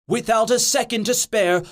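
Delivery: ordinary delivery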